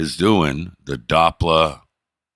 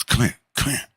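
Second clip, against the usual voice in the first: high, furtive come-here; devious beckoning; 5 semitones higher than usual. Retold or imitated. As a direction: beckon as if plotting something sneaky. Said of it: "I pretend we're doing something devious".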